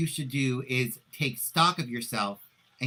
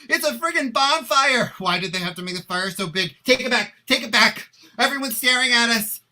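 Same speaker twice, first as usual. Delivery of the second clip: much higher and louder, frantic alarm; panicked yelling; 8 semitones higher, 7 dB louder than usual. Acted. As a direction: shriek as the flaming dish heads your way. Panics as the flaming ham arrives.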